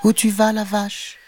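high-pitched kitten voice; small kitten. A narrator's reading. A tabby kitten asks the cow where she's going, high-pitched. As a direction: ask in a little high cat voice. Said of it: "demanda-t-il".

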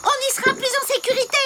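ordinary talk

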